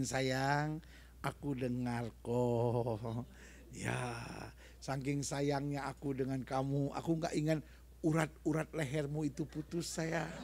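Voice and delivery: soft, low, slow tender voice; gentle reminder. Retold or imitated. Spouse soothing a shouting partner, soft and tender. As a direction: murmur it lovingly to calm a shouting spouse. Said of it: "yang satu ingetin".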